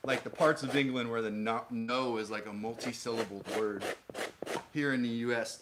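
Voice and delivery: ordinary talk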